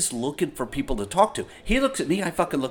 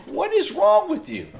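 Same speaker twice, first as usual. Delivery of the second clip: high, loud laughing disbelief; hammed-up shocked squeak; mock disbelief; 5 dB louder than usual. To the crying old man, high mock disbelief. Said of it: "I'm like".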